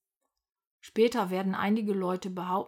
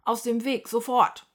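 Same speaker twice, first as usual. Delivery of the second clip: loud, forceful command; bossy policeman bellowing; bellowed order; 3 semitones higher, 7 dB louder than usual. A narrator's reading. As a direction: order it out of the way, louder to the end. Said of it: "brüllt Herr Piefel den Elefanten an".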